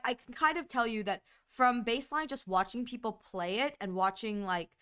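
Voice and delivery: ordinary talk, nothing marked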